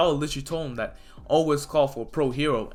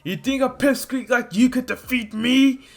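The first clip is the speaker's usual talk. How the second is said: higher, louder scornful sneer; villain taunt; 10 semitones higher, 4 dB louder than usual. Acted. Villain taunting Izuku as a pipsqueak. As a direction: belittle the little kid.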